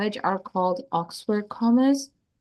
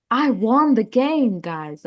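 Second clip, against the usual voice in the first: mildly excited exclamation, pitched up; 3 dB louder, slower than usual. Shown as a demonstration. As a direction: announce a win, stretching the words, dropping off at the end. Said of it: "excited, right? The, the tone you say it".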